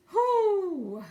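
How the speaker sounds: loud laugh into a whew; laughing exhale. Real, not acted.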